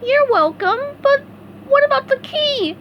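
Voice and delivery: very high, loud distressed wail. A narrator's reading. SpongeBob despairing over the lost key, high wail. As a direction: wail it in dismay. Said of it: "SpongeBob wailed".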